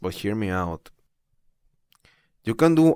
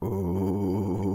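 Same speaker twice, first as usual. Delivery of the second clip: resonant ooh. Shown as a demonstration. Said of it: "The quality in a sound of being deep".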